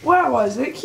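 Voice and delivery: ordinary talk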